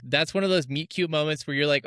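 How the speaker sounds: ordinary delivery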